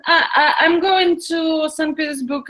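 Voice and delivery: usual speaking voice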